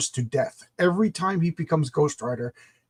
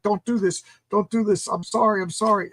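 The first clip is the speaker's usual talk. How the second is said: higher-pitched frantic begging; pleading; 6 semitones higher than usual. Acted. Father begging his powerful son for mercy, pleading.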